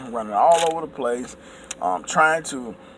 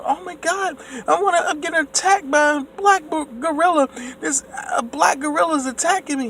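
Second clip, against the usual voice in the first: high, shrill-free mock panic, much higher pitch; imitating a frightened accuser, way up high; 13 semitones higher than usual. Mocking an imagined accuser crying attack, high panicked voice. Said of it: "and then somebody".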